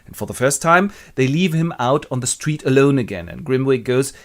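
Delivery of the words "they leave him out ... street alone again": plain reading voice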